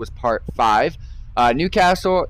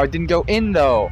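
usual speaking voice

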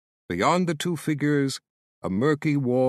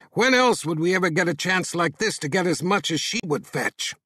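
higher, raised rough-man voice; a lightly voiced greedy thug scheming; greedy captor; 4 semitones higher than usual. A narrator's reading.

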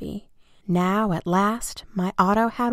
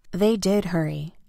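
ordinary delivery, nothing marked

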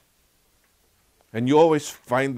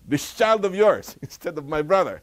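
louder, higher scornful jab; sneering; 7 semitones higher, 5 dB louder than usual. Retold or imitated. Elder brother disowning his sibling, scornful and loud. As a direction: spit out this child of yours with contempt. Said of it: "He didn't even call his brother brother".

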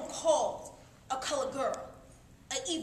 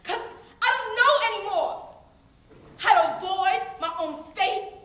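loud, raised plea; despairing outcry; 3 semitones higher, 7 dB louder than usual. Acted. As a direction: cry out that you are lost. Despairing cry in the requiem.

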